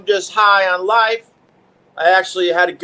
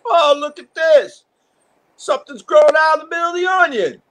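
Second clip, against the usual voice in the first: high, wide-eyed discovery; surprised delight; 11 semitones higher than usual. Retold or imitated. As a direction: gasp with delight at the sprout. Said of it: "And one day I'm like".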